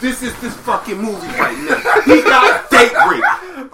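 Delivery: laughing disbelief. Retold or imitated. Pausing the movie in shock, laughing in disbelief. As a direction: laugh through the shock of the plot twist.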